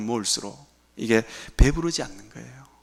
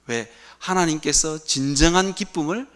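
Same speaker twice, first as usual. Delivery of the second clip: ordinary delivery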